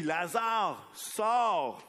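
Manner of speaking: preacher acting Jesus shouting at the tomb; full shout. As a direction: throw the command out with all your strength. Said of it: "tu vois Jésus qui crie".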